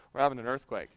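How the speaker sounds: low, soft, offhand remark; casual. Retold or imitated. Brother-in-law casually announcing an earthquake, low and offhand. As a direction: toss it off casually, quietly. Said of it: "he said it so casually".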